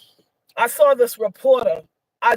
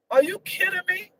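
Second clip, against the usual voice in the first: incredulous; 7 semitones higher, 3 dB quieter than usual. Real, not acted. Outraged rhetorical question.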